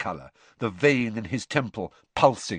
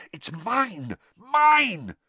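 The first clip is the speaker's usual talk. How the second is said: mouthing; 11 semitones higher, 4 dB louder, much slower than usual. A narrator's reading.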